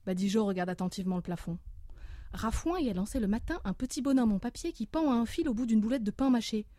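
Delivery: ordinary talk, nothing marked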